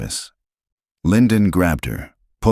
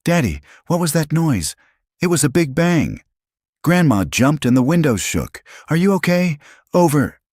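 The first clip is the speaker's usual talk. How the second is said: put-on higher, louder anxious child; a scared little girl on the radio; 12 semitones higher, 4 dB louder than usual. A narrator's reading.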